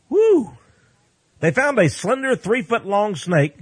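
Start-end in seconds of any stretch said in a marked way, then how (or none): 0.1-0.6 a sudden high, loud whoop of delight; excited whoop. Real, not acted. Preacher whooping at news of legged snakes, excited. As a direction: let out a thrilled yelp.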